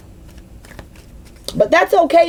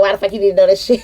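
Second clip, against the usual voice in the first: a hint of her brother cussing, straight; mild mock brother's rough question, no laugh; lightly voiced brother; 4 semitones lower, much faster than usual. Telling how her brother cussed her out, lightly imitated. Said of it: "my brother cussed me out".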